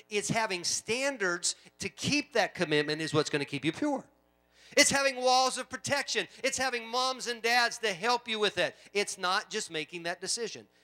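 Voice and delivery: forceful pulpit exhortation; full-force preaching. Real, not acted.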